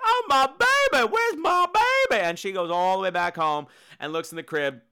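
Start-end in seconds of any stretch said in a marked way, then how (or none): 0.0-2.2 mock panicked mother. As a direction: wail for the lost baby, overdone. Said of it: "she's like".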